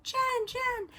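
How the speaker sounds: high, sing-song call of the name, exaggerated; mock her calling from further away; imitating calling out. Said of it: "She's like".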